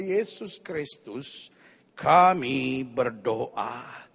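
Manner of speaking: slow, drawn-out prayer sinking low; ceremonious slow praying; mock solemn prayer. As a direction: intone a lengthy prayer, dragging the final words. Acts out a long solemn prayer.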